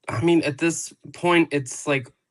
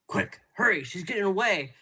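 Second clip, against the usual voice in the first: higher-pitched mock hurry; lightly acted urgent chase call; mock urgency; 6 semitones higher than usual. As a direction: hurry them on, half-acted. Acting out the chasers in retelling.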